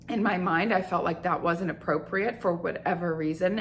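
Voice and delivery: normal talking voice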